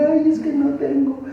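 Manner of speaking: very high-pitched put-on wail; mock crying. Preacher mimics believers crying over sneakers and phones, mock crying. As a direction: wail each complaint like a spoilt crybaby. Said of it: "en lugar de estar llorando ahí".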